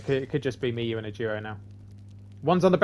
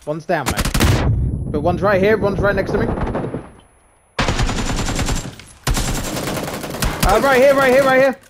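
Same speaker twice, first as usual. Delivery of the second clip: loud, rising battle callout, climbing higher; shouting orders over battle; 7 semitones higher, 9 dB louder than usual. Real, not acted. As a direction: yell it as the enemy closes in. Enemy next to him in battle.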